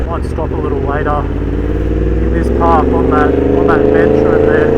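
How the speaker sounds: muffled voice inside a motorcycle helmet; talking into a helmet mic while riding. Real, not acted. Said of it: "I've got the visor closed on the Ruroc Atlas".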